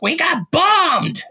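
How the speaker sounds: put-on a high, drawn-out old-lady voice delivering the news; grandmother impression. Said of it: "That's how she talks".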